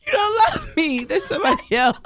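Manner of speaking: high, tearful mock hurt; mock heartbroken. Hurt girlfriend confronting the boyfriend without a gift, mock heartbroken. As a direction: pretend to be crushed by him.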